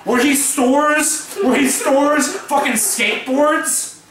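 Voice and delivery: usual speaking voice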